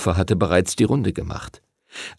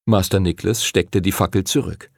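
usual speaking voice

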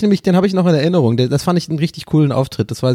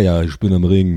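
put-on Kölsch accent; 9 semitones lower than usual. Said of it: "versuch, Köl-, Kölsch zu sprechen".

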